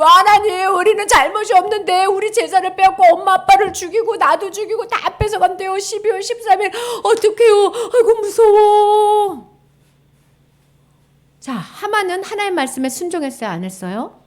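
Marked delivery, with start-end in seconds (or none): 0.0-9.6 high, loud, tearful plea; frightened crying prayer. Acted. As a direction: beg God in terror.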